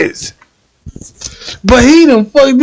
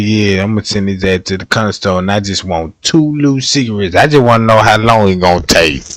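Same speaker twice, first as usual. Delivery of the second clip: put-on scheming girlfriend; 13 semitones lower, 4 dB quieter, faster than usual. Woman voiced sending man out.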